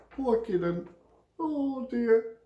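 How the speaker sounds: mock pity. Teacher pitying the friend-zoned Kidon, high mock sympathy.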